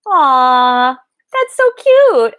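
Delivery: slow, high delighted gush; cooing aw. Real, not acted. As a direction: coo over something adorable. Delighted by a pen.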